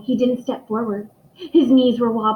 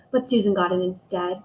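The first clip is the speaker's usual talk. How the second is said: plain reading voice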